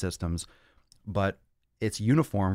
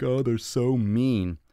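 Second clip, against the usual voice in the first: higher, drawn-out mock whine; mock complaining; 4 semitones higher, much slower than usual. Coach imitating clients who can't take sternness, mock complaining.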